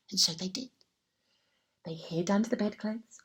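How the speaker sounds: usual speaking voice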